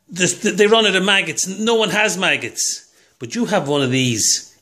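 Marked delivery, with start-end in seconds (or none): none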